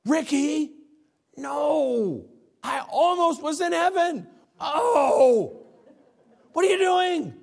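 put-on high, loud, tearful old man crying out; an old deacon wailing to be let go to heaven; dying old deacon. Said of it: "and went".

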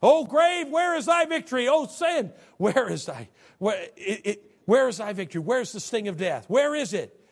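loud, high shouted scripture; fiery preaching. Acted. Preacher defies death before the congregation, shouting. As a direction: taunt death from the pulpit.

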